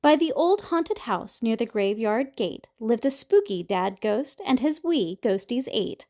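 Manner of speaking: usual speaking voice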